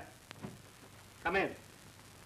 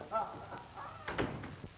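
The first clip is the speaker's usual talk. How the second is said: high laugh; 8 dB quieter than usual. Acted. Delighted after Saul agrees.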